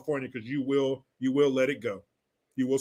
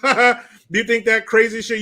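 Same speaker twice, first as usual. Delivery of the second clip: higher and louder, laughing in disbelief; amused, raised-voice incredulity; 6 semitones higher, 6 dB louder than usual. Real, not acted. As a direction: burst out laughing into the question. Laughs at idea of foolish voters.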